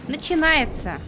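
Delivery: loud, high exasperated groan. Real, not acted. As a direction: here we go again, loud. (раздражённо и громко)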